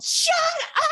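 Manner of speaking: drawn-out yell of disbelief. Retold or imitated. Student hears she is in, yells in disbelief. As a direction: belt out both words, long and stretched. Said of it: "I just yelled".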